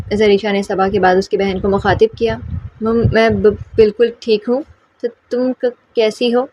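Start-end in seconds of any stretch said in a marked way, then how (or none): 2.8-4.6 halting, catching on the first sounds; stammering shy girl; stuttering. Acted. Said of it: "حق لا کر بولتی تھی". 5.0-6.5 put-on shy girl stammering her question; stuttering. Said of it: "حق لا کر بولتی تھی".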